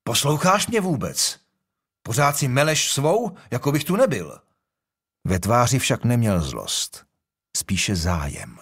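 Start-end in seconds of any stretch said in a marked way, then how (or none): 0.0-4.4 higher, sharp royal rebuke, not truly angry; a king snapping at a cheeky young man, half amused; stern bark. A narrator's reading. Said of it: "Přemysl přísně houkl".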